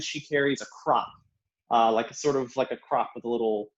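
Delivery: ordinary delivery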